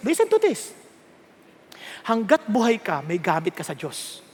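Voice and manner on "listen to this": calling the seniors to listen, pitched up; higher repeat. Shown as a demonstration.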